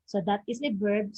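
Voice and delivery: ordinary talk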